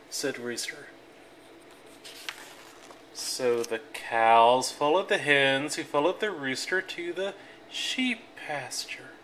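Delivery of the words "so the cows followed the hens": ordinary delivery, nothing marked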